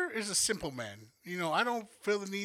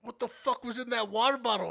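higher, louder frantic roommate; roommate panicking; 6 semitones higher, 4 dB louder than usual. Retold or imitated. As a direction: play the freaked-out roommate calling. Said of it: "He was like".

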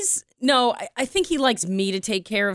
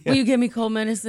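sick voice; 3 semitones higher than usual. Pretend. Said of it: "what was that voice".

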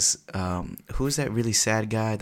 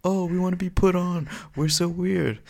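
mocking whiny voice; 10 semitones higher than usual. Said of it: "mocking eager guests".

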